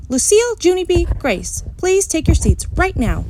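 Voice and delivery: high, loud call of names; teacher holler. A narrator's reading. Teacher calling noisy children to sit down, firm. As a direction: call the kids back to their seats. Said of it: "my teacher hollered".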